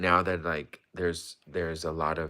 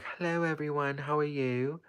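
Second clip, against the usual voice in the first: much higher, posh mock royal greeting; 9 semitones higher than usual. Joking about turning royal from The Crown, high mock royal voice. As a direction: greet everyone like a gracious queen. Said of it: "I would just act so royal".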